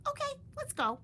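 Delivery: very high bird voice; high eager bird; agreeing. Acted. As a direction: chirp yes. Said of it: "agreed Pip".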